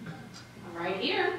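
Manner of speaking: soft, slow, with a rise on "here"; soft, drawn-out "I'm right here"; puzzled reply. Acted. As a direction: answer him quietly and slowly, a little unsure. First answer to lover's request.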